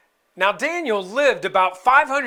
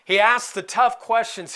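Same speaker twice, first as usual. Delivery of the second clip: normal talking voice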